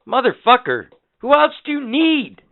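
high, loud mock outburst; loud retold retort; exasperated retort. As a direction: fire it back at the nurse, exasperated. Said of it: "And I'm like".

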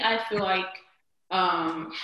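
normal talking voice

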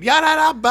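high, loud imitated engine noise; mock engine rev. Imitating the bike revving, high and loud. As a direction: rev it like a motorbike. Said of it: "So I go fucking".

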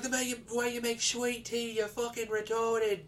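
lightly voiced sneering Brit; imitating a British gamer. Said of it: "He was like".

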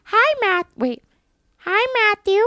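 high, squeaky Elmo. Shown as a demonstration. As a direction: chirp it like the red puppet. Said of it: "I can do Elmo".

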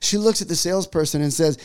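usual speaking voice

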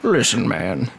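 low, measured impression; deep, composed celebrity imitation; Morgan Freeman. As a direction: drop the pitch and play the calm authority. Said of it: "Like, Morgan Freeman voice".

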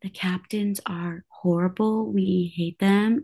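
hushed, slow conspiratorial whisper. Retold or imitated. Whispering to the mic'd-up captains. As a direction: lean in and whisper a secret. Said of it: "lean in and be like".

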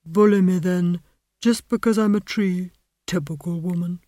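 put-on a put-upon old tree, grumbling; sulky talking tree with a grudge; aggrieved tree voice. A narrator's reading. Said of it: "the tree said".

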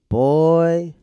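amazed exclamation. Real, not acted. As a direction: stretch it out loudly in relish. Exclaims at character's shady behaviour.

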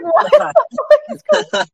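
high, incredulous, half-laughing; mock disbelief at the scene; baffled viewer. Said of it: "And it's just like".

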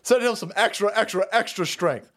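normal talking voice

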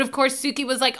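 ordinary talk, nothing marked

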